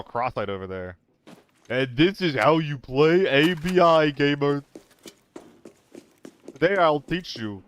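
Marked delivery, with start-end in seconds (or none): 1.7-7.6 hammed-up gaming-mentor teacher voice. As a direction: lecture a newbie like a smug mentor. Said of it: "Trying to talk like Ed".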